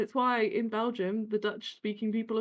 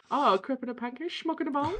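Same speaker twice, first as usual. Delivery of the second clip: put-on comic Dutch accent, climbing higher; caricatured Dutch, lifting at the end; Goldmember; 4 semitones higher than usual. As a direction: do a silly film-villain Dutch accent. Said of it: "gold member Dutch accents".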